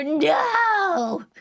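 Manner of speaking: high, drawn-out comic cry; hammed-up high protest; mock wail. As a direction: wail it out in pretend despair. Mock answer to own question.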